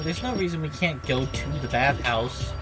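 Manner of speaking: ordinary talk, nothing marked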